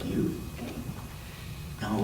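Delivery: ordinary delivery, nothing marked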